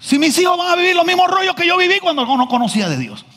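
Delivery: high, urgent pleading; impassioned preaching. Acted. (súplica apasionada y aguda)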